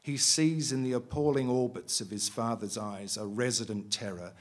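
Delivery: steady, measured reading of a novel; story reading. A narrator's reading. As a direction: read it like an author at a bookshop. Author resumes reading novel.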